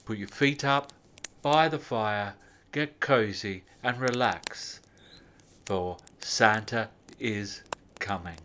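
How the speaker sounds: ordinary delivery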